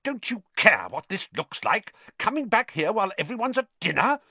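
high, indignant reproach, speeding up; fussy indignant lecture; scolding. A narrator's reading. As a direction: tell off a younger brother officiously. Percy scolds his brother.

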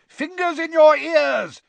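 high, loud, drawn-out bellow. A narrator's reading. Father warns the children as the dancing starts, bellowing. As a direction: bellow a slow warning. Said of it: "bellowed Mister Weasley".

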